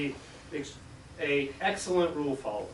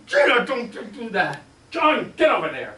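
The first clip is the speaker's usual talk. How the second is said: louder, higher sharp command; scolding order; 7 semitones higher, 7 dB louder than usual. Retold or imitated. Debbie scolding Gina and sending John away. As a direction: scold them both.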